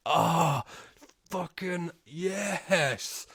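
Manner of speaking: very slow, drawn-out delighted yes; relishing the film; savoring delight. Real, not acted. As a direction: stretch it out in bliss. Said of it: "drowning in the goodness".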